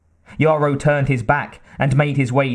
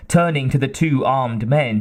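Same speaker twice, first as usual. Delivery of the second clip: ordinary delivery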